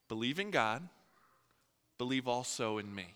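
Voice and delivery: Jesus softly asking the apostles to trust him; gentle reassurance. Acted. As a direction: reassure them quietly. Jesus calming frightened apostles.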